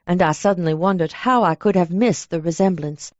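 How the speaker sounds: ordinary delivery, nothing marked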